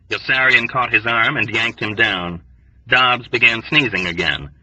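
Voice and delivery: ordinary delivery, nothing marked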